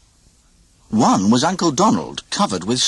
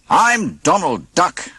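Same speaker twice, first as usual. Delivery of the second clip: proud self-introduction; 8 semitones higher, slower than usual. A narrator's reading. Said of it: "he said to the innkeeper".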